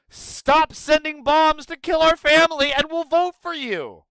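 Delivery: high, loud quoted demand; mock pleading demand. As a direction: shout the simple demand. Quoting the community's demand.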